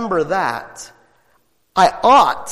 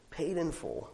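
Paid in full almost whispered, much softer; whispered softly; 15 dB quieter than usual. Shown as a demonstration. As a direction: drop to a hush on Paid in full. Soft repeat of phrase.

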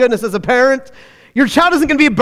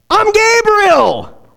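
higher, slow, majestic declaration; Gabriel's grand announcement; 10 semitones higher, much slower than usual. Acted. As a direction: announce the name with awe. Angel Gabriel announces himself.

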